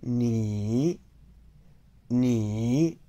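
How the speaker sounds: careful dipping Mandarin "ni"; third tone. Shown as a demonstration. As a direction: draw "ni" down, then lift the end. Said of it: "Notice the tone mark".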